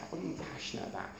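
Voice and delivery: hushed fast whisper; quick breathy echo. Shown as a demonstration. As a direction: whisper the question.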